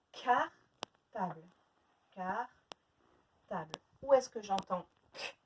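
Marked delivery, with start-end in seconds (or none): 0.1-3.8 "cartable" very slow, in two separate parts; split into syllables. Shown as a demonstration. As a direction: pause between the syllables of "cartable". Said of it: "Faites le nombre de syllabes".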